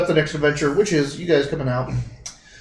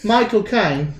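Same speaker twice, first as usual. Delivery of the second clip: "Michael Caine" higher, in Australian vowels; Australian accent; 5 semitones higher, slower than usual. Shown as a demonstration. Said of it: "Michael Caine in an Australian accent".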